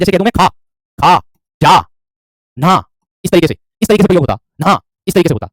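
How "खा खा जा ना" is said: खा, जा, ना each said alone with an open "aa" ending; long final आ sound. Shown as a demonstration. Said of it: "आ ध्वनि का उच्चारण होता है".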